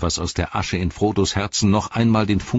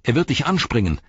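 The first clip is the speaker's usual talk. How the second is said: higher-pitched urgent alert; called warning; 7 semitones higher than usual. A narrator's reading. Sam sees Gollum about to leap, called warning. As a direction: warn him of the coming attack. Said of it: "rief Sam".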